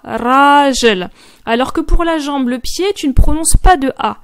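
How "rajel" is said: rajel with a big open drawn-out A; long A. Shown as a demonstration. Teacher stressing the grand A in rajel for man. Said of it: "tu vas prononcer un grand A".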